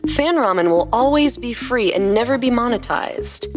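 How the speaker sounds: plain reading voice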